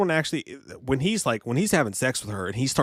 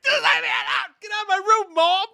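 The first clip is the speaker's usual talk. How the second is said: put-on much higher, shouted bratty teenager; whiny teen yelling at his mother to get out; sulky teen yell; 3 dB louder than usual. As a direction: scream at mom like a moody teen. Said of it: "he went immediately from".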